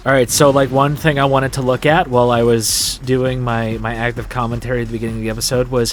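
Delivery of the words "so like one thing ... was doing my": ordinary talk, nothing marked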